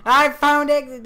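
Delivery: high, loud excited shout. Real, not acted. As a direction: yell it out thrilled. Finds the exit in game.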